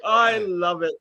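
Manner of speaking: high delighted laughing words. Real, not acted.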